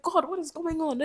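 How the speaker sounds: high-pitched exasperated plea, not full volume; mock screaming. Complaining to God about the year, mock screaming. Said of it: "I'm screaming, I'm shouting".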